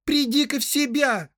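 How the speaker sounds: very high, loud female scolding; Rosie snapping. A narrator's reading. Rosie rebuking her sweetheart, high-pitched scolding. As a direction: snap at him in a high girl's voice. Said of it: "прикрикнула Рози".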